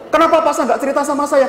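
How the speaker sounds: very high accusing complaint; imitated indignant protest. Wife asking why counsellor never told her, imitated indignant protest.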